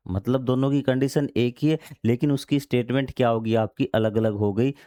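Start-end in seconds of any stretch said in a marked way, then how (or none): none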